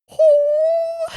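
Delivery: very high crying wail; emotional wail. Real, not acted. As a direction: wail as if heartbroken. Overcome by the scene.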